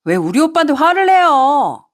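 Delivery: whiny protest on her man's behalf; angry drunk mistress. Acted. Drunk mistress defends her lover to his wife, indignant. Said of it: "대뜸 화를 내기 시작하더라고요".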